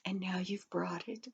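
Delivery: whispered with gratitude; grateful whisper; hushed. Acted. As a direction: breathe it out in a whisper. Lady's grateful hushed aside.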